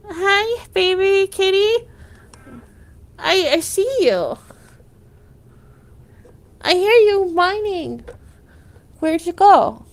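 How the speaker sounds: talking sweetly to a kitty. Real, not acted. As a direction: coo to the cat. Talking to her cat.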